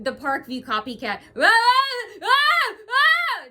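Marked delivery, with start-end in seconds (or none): 1.4-3.4 high, loud imitation scream; mock scream. Vlogger imitating hecklers who cut her off, screaming. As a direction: burst out with short mocking screams. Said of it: "they start scream".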